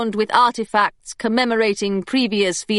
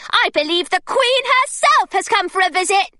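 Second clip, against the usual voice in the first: put-on much higher, gushing girl voice; eager schoolgirl; 11 semitones higher than usual. A narrator's reading. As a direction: overwhelm him with enthusiasm. Claire distracting the secretary.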